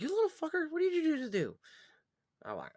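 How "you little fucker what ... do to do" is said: high, shrill-pitched mom yell; mock-yell like an exasperated mom; scolding mom. Mom scolding him as a kid, high mock yell. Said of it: "She's like".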